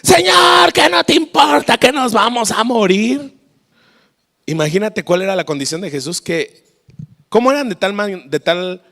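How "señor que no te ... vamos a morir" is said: high, panicked-sounding shout; loud cry, starting at full pitch; shouting disciples. Acted. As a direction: yell for help over a storm. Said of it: "y estos gritando".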